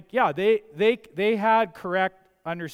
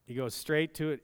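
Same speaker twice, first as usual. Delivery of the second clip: normal talking voice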